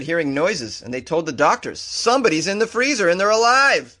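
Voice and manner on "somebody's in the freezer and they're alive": high, urgent cry of alarm; raised, excited mock alarm; alarmed call. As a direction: call out the shocking news in mock panic. Acts out alarmed call to doctors.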